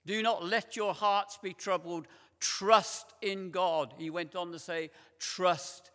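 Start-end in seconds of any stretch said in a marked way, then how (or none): 0.1-3.9 louder, punching not and trust; 힘주어 크게 읽는 성경 구절; emphatic quote. Shown as a demonstration. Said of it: "What's the next word? Trust". 5.2-5.9 Trust hit hard and louder; stress on Trust. Shown as a demonstration. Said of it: "emphatic repeat of trust".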